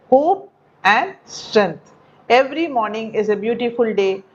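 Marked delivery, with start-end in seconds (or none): 0.1-1.9 very slow stressed answer. Shown as a demonstration. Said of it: "repeating the answer slowly".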